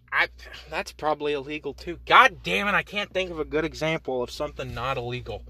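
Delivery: normal talking voice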